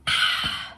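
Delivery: long unvoiced breath out; breathless sigh. Real, not acted. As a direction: let the air rush out, winded. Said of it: "I'm so out of breath".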